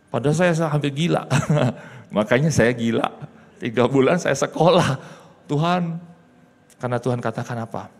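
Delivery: normal talking voice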